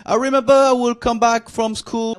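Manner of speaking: ordinary talk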